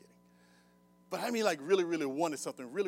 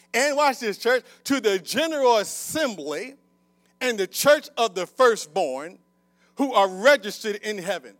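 loud, raised, weighty verse reading; proclaiming scripture; 5 semitones higher, 10 dB louder than usual. Real, not acted. Preacher reading Hebrews to the church, proclaiming it. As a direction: ring out each phrase to the congregation.

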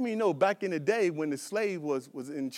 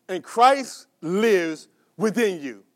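slow scripture reading; 2 semitones lower, 3 dB louder, much slower than usual. Shown as a demonstration. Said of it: "watch this".

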